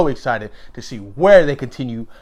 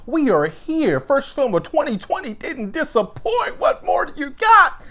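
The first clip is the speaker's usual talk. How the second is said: much higher, buzzing with excitement; thrilled reaction; 10 semitones higher than usual. Retold or imitated. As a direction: gush like a fan who just got blown away. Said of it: "I was like".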